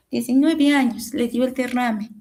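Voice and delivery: ordinary talk, nothing marked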